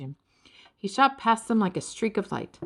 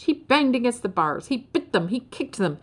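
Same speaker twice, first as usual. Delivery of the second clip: ordinary delivery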